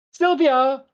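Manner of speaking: high, sung-out summons; loud theatrical call, name stretched out. Acted. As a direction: holler for an assistant on a long high note. Shop owner calls the assistant.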